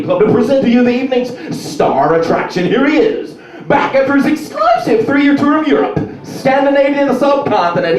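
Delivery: high, loud wrestling announcer build; announcer intro. Pretend. Comedian's dream wrestling-style introduction, loud announcer. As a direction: boom it out like a ring announcer. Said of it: "I want something to be like".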